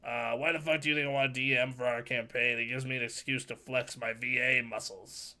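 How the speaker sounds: usual speaking voice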